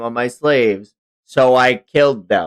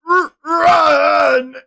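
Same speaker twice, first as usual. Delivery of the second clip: panicked shout; 6 dB louder than usual. Acted. Character fleeing in panic.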